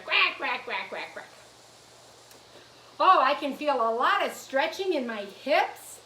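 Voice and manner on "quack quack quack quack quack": fading string of duck quacks; duck quacking. Pretend. Teacher plays duck with the children, quacking. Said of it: "all those ducks quacking out there".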